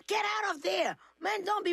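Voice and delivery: high shouted scolding; mock yell. Mom shouting at her daughter in the dressing room, high mock yell. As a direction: yell at the kid to get out. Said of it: "And she guys go".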